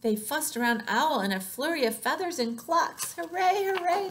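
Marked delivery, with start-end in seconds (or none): 3.1-4.1 high, loud hurrah; cheering shout. A narrator's reading. Hens fuss around owl in celebration, high cheer. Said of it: "they shouted".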